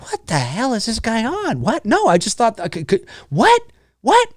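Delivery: high, baffled reaction voice; confused bystander. Retold or imitated. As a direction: sound baffled by a nonsensical accusation. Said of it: "It's like".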